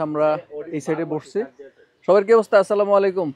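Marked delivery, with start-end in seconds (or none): 2.1-3.3 loud, high, bright hello to the group; cheerful loud greeting. Real, not acted. Greeting friends on arrival. (বন্ধুদের দেখে উচ্ছ্বসিত জোরালো সালাম)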